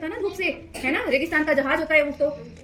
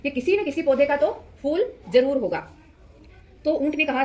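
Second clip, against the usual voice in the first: usual speaking voice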